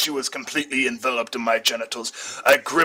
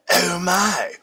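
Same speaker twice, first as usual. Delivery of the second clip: lady's voice attempt; 6 semitones higher than usual. A narrator's reading. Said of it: "that's the lady's part".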